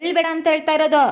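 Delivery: loud, high, exasperated order; out-of-patience parental shout; angry scolding. Acted. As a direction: raise your voice at a crying child. Father scolds child for crying.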